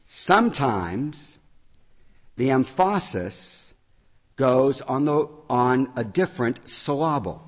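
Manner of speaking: deliberately misplace the stress in "emphasis" and "syllable" onto the middle, slowly with pauses; wrong-syllable stress. Shown as a demonstration. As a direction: say "emphasis" as em-FAH-sis and "syllable" as suh-LAH-bul. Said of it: "if I just tweak it a little bit, what I actually said was".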